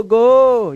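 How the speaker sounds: "गो" loud with a long O; final O sound. Shown as a demonstration. Teacher repeats the O example, loud drawn-out "गो". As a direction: call out "गो" and hold it. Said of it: "तो ओ को ओ पढ़ते हैं".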